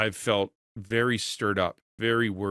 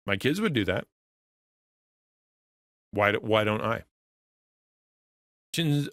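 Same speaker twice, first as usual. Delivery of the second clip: usual speaking voice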